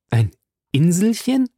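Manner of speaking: high, rising disbelief; astonished question. A narrator's reading. Professor questioning the harpooner's islet, astonished. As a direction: echo it back, puzzled.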